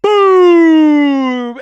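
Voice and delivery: loud, high, long boom; hype boom. Real, not acted. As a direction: let the boom ring out. Celebrating a super chat.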